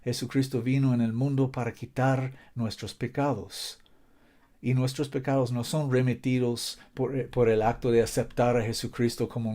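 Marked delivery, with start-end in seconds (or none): none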